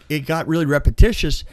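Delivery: normal talking voice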